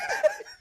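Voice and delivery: laughing. Real, not acted. Laughing at wood burning joke.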